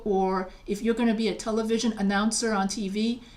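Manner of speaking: ordinary delivery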